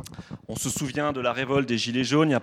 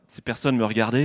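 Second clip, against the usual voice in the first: ordinary talk, nothing marked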